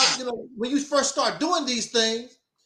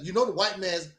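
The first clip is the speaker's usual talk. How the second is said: mock quote, quieter; 6 semitones lower, 5 dB quieter than usual. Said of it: "Never heard him talk like that".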